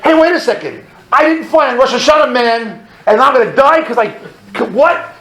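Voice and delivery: high, loud bargaining with God; acted indignant protest. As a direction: argue upward in panicked disbelief. Protests to God in falling plane.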